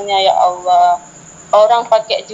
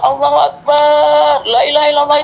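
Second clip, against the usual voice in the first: shouted takbir; 6 semitones higher than usual. Retold or imitated. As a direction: shout it after the fleeing man. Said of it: "Enggak saya bilang tolong-tolong".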